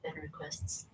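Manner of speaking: breathy voiceless whisper; retold whisper. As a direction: whisper it close into an ear. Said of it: "leans in really close to my ear and goes".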